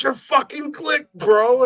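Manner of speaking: high, slow, amazed insistence; starstruck drunk fan. Retold or imitated. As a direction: stretch it high like an awed fan. Drunk fan recognizes the host.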